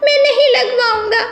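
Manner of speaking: put-on high child voice, begging not to get a shot; a little parrot pleading with his papa; scared whiny child. Parrot child refuses injection.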